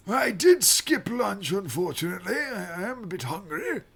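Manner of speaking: put-on high, frail old man voice; quavering elderly temple master.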